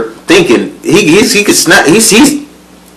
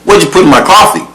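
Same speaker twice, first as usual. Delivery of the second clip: raised-pitch retold confrontation; higher, no-nonsense questioning; blunt demand; 8 semitones higher than usual. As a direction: imitate him demanding a straight answer. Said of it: "Wasn't no beating around the bush".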